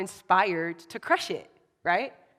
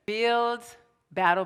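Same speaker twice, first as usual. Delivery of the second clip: normal talking voice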